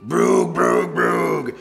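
very low, loud goofy noise; silly sounds. Acted. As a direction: drop way down for booming nonsense. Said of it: "Make silly sounds like".

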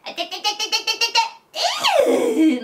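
put-on high babbling baby squeal with laughter; Himawari baby voice. Shown as a demonstration. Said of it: "クレヨンしんちゃんのひまわりみたいな". (ひまわりみたいに高い赤ちゃん声で)

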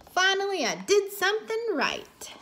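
high-pitched, pleased exclamation; triumphant dog. A narrator's reading. Marley celebrates saving the baby, high and triumphant. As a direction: exclaim it as a proud, relieved dog.

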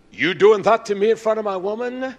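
high, loud indignant outburst; imitated outrage. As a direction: act out a man loudly taking offense. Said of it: "He said".

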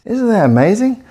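high excited question; bright gush of amazement; thrilled. Real, not acted. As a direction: share the wonder. Star effect surprises.

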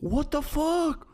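high, drawn-out stunned cry; shocked disbelief. Real, not acted.

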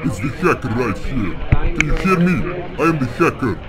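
processed voice-changer voice. Real, not acted. Hacker confronting a scammer, voice changer. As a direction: speak through a voice changer. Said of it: "get off that voice changer".

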